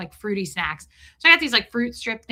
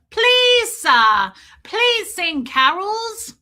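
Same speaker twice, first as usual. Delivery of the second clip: high, loud, drawn-out begging; pleading little girls; 10 semitones higher, 7 dB louder, much slower than usual. Retold or imitated. Little girls begging a man for carols, high pleading. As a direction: beg like small children, stretching each please.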